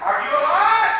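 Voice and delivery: high, loud are you alive; loud call. Acted. Nurse calling loudly to the wounded boy, high and loud. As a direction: shout the question at him.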